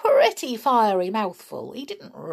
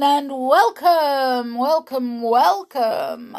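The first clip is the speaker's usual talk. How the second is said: sing-song welcome; 7 semitones higher, 5 dB louder, slower than usual. Real, not acted. Playful drawn-out repeated welcome.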